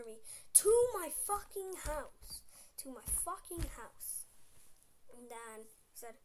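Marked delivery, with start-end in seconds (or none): 0.6-2.4 very loud, high, slow; loud emphatic. Real, not acted. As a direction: yell to my fucking house, drawn out. Retelling the chase, repeated.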